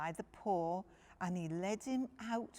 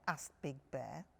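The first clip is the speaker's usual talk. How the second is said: plain reading voice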